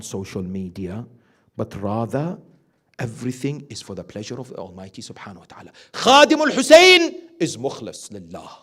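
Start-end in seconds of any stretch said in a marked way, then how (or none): 5.9-8.5 loud, higher preaching voice, driving each point home; fervent preaching. Acted. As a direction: preach it out to the congregation. Preaching at full force.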